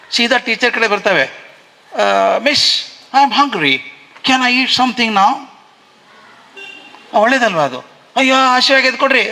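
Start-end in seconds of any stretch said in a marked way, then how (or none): 1.2-5.5 high, slow little pupil voice; imitated small child. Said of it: "ಪುಟ್ಟ ಮಕ್ಕಳು ಎಷ್ಟು ಚಂದ ಮಾಡ್ತಾವ್ರೆ". 8.2-9.3 high-pitched mock pleading; acted-out hungry child. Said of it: "action ತೋರಿಸೋಕ್ಕಿಂತ".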